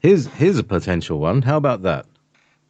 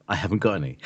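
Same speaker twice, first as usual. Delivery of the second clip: usual speaking voice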